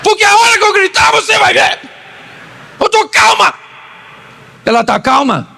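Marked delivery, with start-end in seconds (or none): 0.0-3.5 much higher mock wife insisting she is calm; wife yelling that she is calm, in a high mock voice. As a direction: squeal like an upset wife denying she shouts. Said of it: "E ela falou assim".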